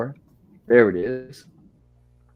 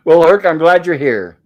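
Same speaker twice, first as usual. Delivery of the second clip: laughing; 4 semitones higher, 9 dB louder than usual. Real, not acted. Laughing after Herc's joke.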